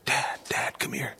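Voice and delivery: put-on hushed teen whisper to dad. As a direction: lean in and breathe it, barely voiced. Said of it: "coming up to him and say".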